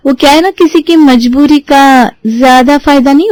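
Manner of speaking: sly mocking tone; smug. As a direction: rub it in sweetly. Naina gloating at mother-in-law.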